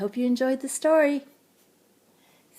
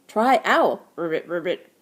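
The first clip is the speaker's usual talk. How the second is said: loud on try owl, then low croaky ribbits; croaking frog; 3 semitones lower, 5 dB louder than usual. A narrator's reading. Frog pointing Jessie to the owl, croaky. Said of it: "croaked the frog".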